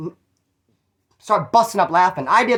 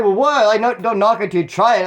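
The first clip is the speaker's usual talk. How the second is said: louder, higher protest; defensive; 4 semitones higher, 5 dB louder than usual. Retold or imitated. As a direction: push back, mildly offended. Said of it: "He's like".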